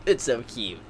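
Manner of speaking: low, soft murmur of adoration; quietly gushing. Real, not acted. Fan looking at pictures in the book, quietly gushing. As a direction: say it small, nearly to yourself. Same words after the squeal, subdued.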